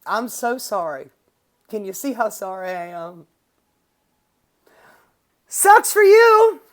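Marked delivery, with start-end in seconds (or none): 5.5-6.6 loud, high, slow taunt; mocking. Real, not acted. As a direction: rub it in, drawn out and high. Mocking the congresswoman's bad luck.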